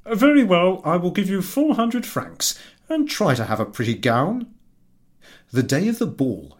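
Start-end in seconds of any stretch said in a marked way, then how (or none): 0.1-4.5 higher, louder eager husband; pleading with his wife to be glad; husband's voice. Acted. Husband to wife about the invitation, higher and eager.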